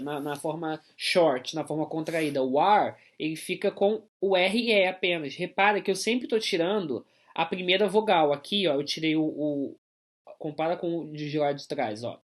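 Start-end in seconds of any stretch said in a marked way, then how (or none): none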